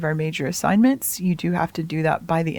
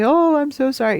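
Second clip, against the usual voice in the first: higher-pitched imitation of an apologetic student; mock groveling apology; 7 semitones higher, 4 dB louder than usual. Student needlessly apologizing to the teacher for late work, mock groveling. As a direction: plead in an exaggerated student apology. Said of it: "like, grovel or say".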